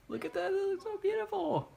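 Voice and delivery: very high cooing admiration. Real, not acted. Admiring her burgers in the pan, high cooing voice.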